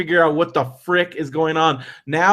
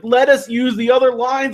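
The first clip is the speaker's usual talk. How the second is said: pleading; 7 semitones higher than usual. Retold or imitated. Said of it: "People are begging the security guards".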